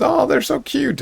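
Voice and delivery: sudden high squeal of delight; excited exclaim. Acted. Octavia delighted by the kobolds, high excited squeal. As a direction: gush over the cute kobolds. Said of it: "Octavia exclaims".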